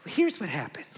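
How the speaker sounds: lightly voiced mom, soft and halting; confiding mom voice. Mom telling a lunch story, lightly imitated confiding voice. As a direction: imitate a mother softly launching into her tale. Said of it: "She's like".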